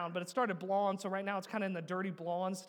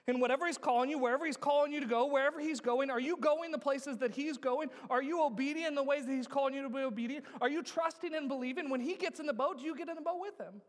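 raised, driving pulpit voice; higher-pitched, insistent run of questions; urgent preaching; 5 semitones higher than usual. Real, not acted. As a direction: press each question on the congregation. Preaches with rising force.

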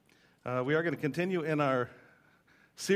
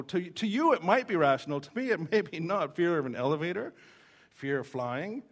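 normal talking voice

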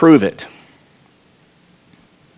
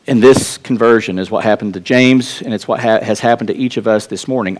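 usual speaking voice

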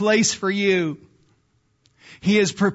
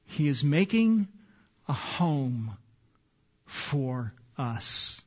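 drops to a hushed, much softer voice; 6 semitones lower, 8 dB quieter, slower than usual. Real, not acted. Pastor confiding to the congregation, hushed and much softer. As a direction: let it fall almost to a whisper. Preacher softens to an intimate hush.

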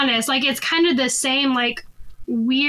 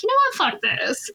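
defiant outburst; 7 semitones higher than usual. Retold or imitated. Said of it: "that moment of, like".

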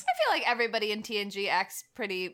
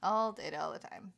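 whisper; 3 semitones lower, 5 dB quieter than usual. Real, not acted. Repeating the catchphrase.

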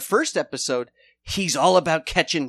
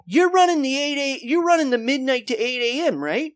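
much higher, loud hammed-up cop calling out; barking Commish; 10 semitones higher, 4 dB louder than usual. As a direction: summon your lazy officers. Commish calling officers over.

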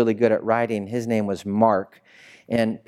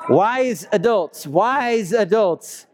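much higher and very slow, savoring wise adults; careful correction; 9 semitones higher, much slower than usual. Real, not acted. Preacher correcting himself to older listeners. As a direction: lean hard on wise adults. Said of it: "I messed up".

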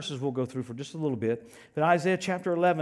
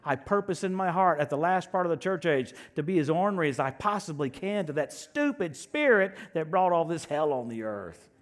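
ordinary delivery

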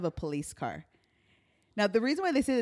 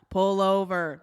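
loud cop command, pitched down; police officer's order; 3 semitones lower, 5 dB louder, much slower than usual. Retold or imitated. Cop repeating the order to the driver, loud command.

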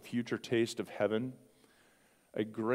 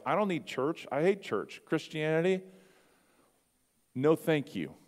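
dismissive rebel; 7 semitones higher, 4 dB louder than usual. Pretend. Mock younger brother type.

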